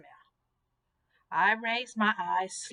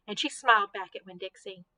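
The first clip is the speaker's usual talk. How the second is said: usual speaking voice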